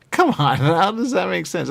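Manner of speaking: loud, high-pitched incredulous protest; exasperated outburst. Real, not acted. Protesting that a sofa can't talk, exasperated outburst.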